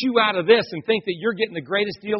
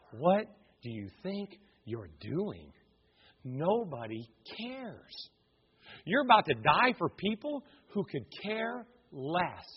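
devil's whisper; 10 dB quieter, slower than usual. Retold or imitated. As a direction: sneer it quietly and slowly into his ear. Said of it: "The devil's whispering in his ear".

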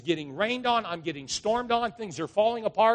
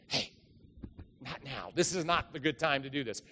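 softer hushed warning, keep it down; hushed aside; 4 semitones lower, 7 dB quieter than usual. Retold or imitated. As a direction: shoo him off under your breath. Servants hush the messenger.

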